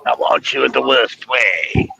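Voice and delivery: slow, drawn-out silly voice; hot dog impression. Job applicant showing off to interviewer, silly hot dog impression. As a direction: stretch it out as a joke impression. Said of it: "a great impression of a hot dog".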